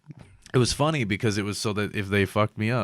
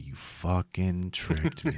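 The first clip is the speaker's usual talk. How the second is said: soft, drawn-out accusation; drugged mock accusation; 6 dB quieter, much slower than usual. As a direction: stretch every word slowly, half under your breath.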